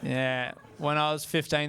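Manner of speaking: usual speaking voice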